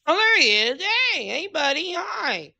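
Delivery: high, loud, delighted hello; excited greeting. Real, not acted. Player spots the Jedi he is rescuing, excited greeting. Greeting someone just found in game.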